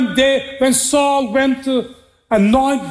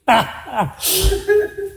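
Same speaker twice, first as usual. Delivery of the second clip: high laugh; 9 semitones higher than usual. Real, not acted. Preacher laughs at the question.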